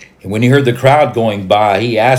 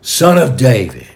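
raised, narrated call; crying out; 3 semitones higher, 6 dB louder than usual. A narrator's reading. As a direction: cry out loudly to someone passing by. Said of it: "And he cried out".

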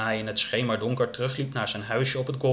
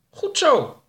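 high, loud cheer; high-voiced little cheer; called-out approval; 7 dB louder than usual. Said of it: "riep Oroka".

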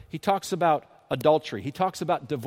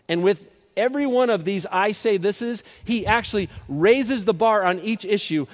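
raised, loud pulpit voice, slowing right down on the last line; full-force preaching; 6 semitones higher, 7 dB louder than usual. Acted. Pastor driving the point home to his congregation, loud and rising. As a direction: preach it hard, climbing to the big finish. Preaching, climaxing on how in the world.

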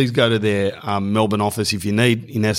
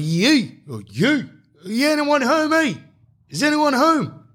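calling at the door. Retold or imitated. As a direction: boom it out like a big guy at the door.

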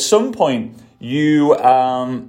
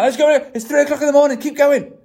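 much higher, relentless pushing orders; drill sergeant; 10 semitones higher than usual. Retold or imitated. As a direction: keep driving the team like a drill instructor. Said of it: "You're gonna sound like a drill sergeant".

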